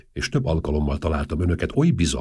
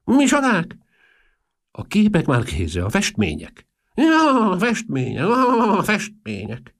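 put-on very high, loud old eccentric; Marchese; 5 dB louder, slower than usual. A narrator's reading. Old Marchese grasping what pictures are meant, high exclaiming voice. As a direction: cry out in a piping old voice, stretching words.

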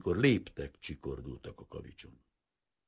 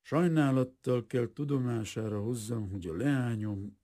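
formal, cool announcement, higher pitch; slow, cool voice; 6 semitones higher than usual. A narrator's reading. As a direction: announce it with cool, measured regret. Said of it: "lassú, hűvös hangon mondta".

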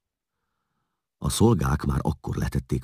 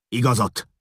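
sharp, high outburst; sudden angry demand, pitched up; snapped command; 10 semitones higher, 4 dB louder than usual. A narrator's reading. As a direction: snap it out in anger. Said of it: "csattant Rea".

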